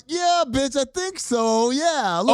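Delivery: high, sassy "Yeah, bitch, I think so"; mock sassy president. Podcaster voicing the president to her staff, sassy mock voice. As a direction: camp it up as a brash president. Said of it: "And she says".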